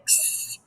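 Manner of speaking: S held as sss; letter sound. Shown as a demonstration. Teacher repeating what C and E make, S as sss. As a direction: make S a drawn hiss, not the letter name. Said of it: "The C and E together make".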